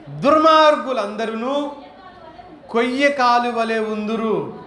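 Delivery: slow, weighty reading of a Bible verse; solemn scripture reading. Acted. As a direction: read it out like holy scripture, measured and grave. Reads scripture verse aloud. (గంభీరంగా లేఖనం చదవడం)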